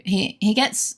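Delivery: normal talking voice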